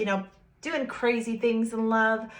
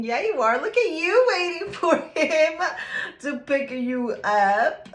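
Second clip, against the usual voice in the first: teasing; 8 semitones higher, 6 dB louder than usual. Acted. Teasing the card figure waiting.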